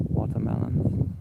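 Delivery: "watermelons" whispered, with a hissed final S; whispered S demo. Shown as a demonstration. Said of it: "pronounce the S at the end of the word watermelons".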